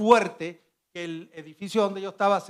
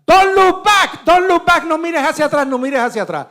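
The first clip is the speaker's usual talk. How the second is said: much higher, much louder retold yell; police shouting; 11 semitones higher, 10 dB louder than usual. Police behind the tape yelling at the survivor, loud higher retold shouting. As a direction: bark the warning like a cop at the tape. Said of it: "empiezan a gritar".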